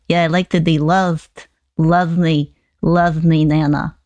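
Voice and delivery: normal talking voice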